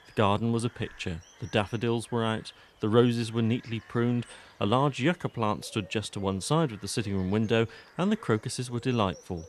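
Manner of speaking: usual speaking voice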